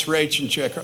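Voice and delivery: ordinary talk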